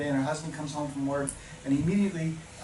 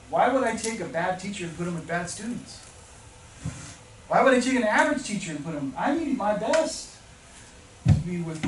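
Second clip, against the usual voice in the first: higher, louder impassioned plea; emphatic; 6 semitones higher, 6 dB louder than usual. Real, not acted. Speaker challenging teachers about struggling students, emphatic. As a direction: argue it with force, building on each question.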